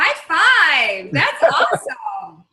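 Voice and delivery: loud, high, stretched-out congratulations; big drawn-out whoop of approval; excited cheering. Real, not acted. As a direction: cheer them on with a laugh. Cheering his news about yoga.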